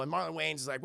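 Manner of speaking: ordinary talk, nothing marked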